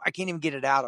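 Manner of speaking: ordinary delivery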